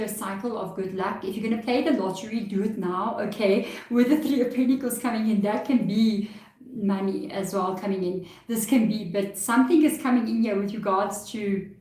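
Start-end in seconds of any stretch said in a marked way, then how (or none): none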